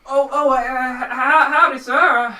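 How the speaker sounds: high, loud stammering farmer; flustered country-farmer character voice; startled farmer. Acted. Game master voices startled farmer.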